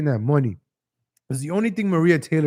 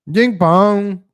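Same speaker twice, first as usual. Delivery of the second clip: loud, higher mock gibberish; John Cena mock voice; 4 semitones higher, 5 dB louder than usual. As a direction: bark the nonsense words out, lightly mocking. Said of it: "That's how John Cena talks".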